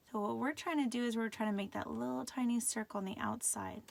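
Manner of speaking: normal talking voice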